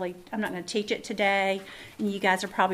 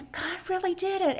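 awed amazement; 10 semitones higher than usual. Pretend. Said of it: "you're gonna go".

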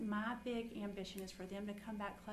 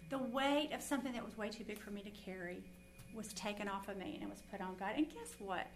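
usual speaking voice

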